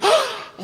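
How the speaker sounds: sharp, high, loud gasp; mock gasp of sudden realisation; exaggerated gasp. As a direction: suck in air as if just remembering. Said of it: "Terus dia bisa begini".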